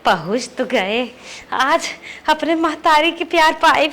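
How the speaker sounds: softly wistful, with pauses between phrases; hushed, heartfelt murmur of gratitude; soft fond musing. Acted. Alone, musing fondly on family love.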